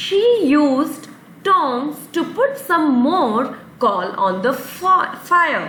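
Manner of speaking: very slow, word-by-word example sentence; slow read-aloud. Shown as a demonstration. Teacher reads the tongs sentence very slowly to the class. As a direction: spell it out slowly so kids follow. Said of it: "teacher reads example sentence slowly".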